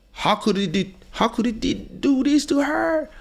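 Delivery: high, far mock-gasping outraged public; mock outrage. The woke crowd reacts to her story, high mock outrage. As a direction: ham up the shocked crowd.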